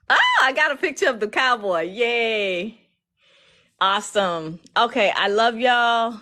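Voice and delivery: real happy excitement; excited. Real, not acted. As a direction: gush happily at the viewers. Celebrating the milestone with viewers.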